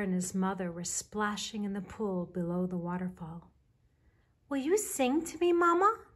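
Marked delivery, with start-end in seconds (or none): 4.5-6.0 high, louder little bear calling out; mild shout. A narrator's reading. Baby Bear calls to Mama over the waterfall, mild shout. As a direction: call up to Mama in a high child voice. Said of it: "shouted Baby Bear".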